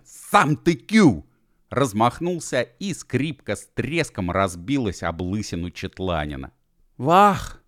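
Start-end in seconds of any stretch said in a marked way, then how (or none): 0.0-1.2 very loud, very slow, much higher yell; shouting. Acted. Bi screams the insult back at U Ef, furious shout. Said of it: "с криком". 7.0-7.6 high, loud horrified shriek; cry of horror. Acted. Gedevan cries out in horror at the broken violin. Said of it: "в ужасе закричал Гедеван".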